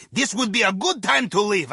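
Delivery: ordinary talk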